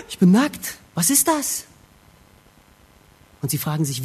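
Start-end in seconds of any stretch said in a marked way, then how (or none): 0.1-1.7 acted shock, pitch rising; startled realisation. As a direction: discover it with a start, then question higher. Adam entdeckt erschrocken seine Nacktheit. (gespielt erschrocken)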